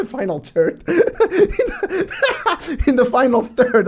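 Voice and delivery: cracking up mid-sentence; giggling through the words; laughing fit over a phrase. Real, not acted.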